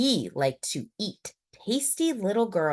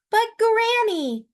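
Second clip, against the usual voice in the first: put-on high, louder girl's voice; Little Red; 6 dB louder than usual. A narrator's reading. Little Red to the wolf, high and girlish.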